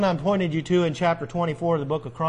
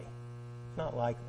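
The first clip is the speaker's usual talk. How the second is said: much softer, dropping the voice; soft echo; 6 semitones lower, 14 dB quieter than usual. Shown as a demonstration. Preacher softly echoing his own warning to the congregation. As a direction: let it fall away as an echo.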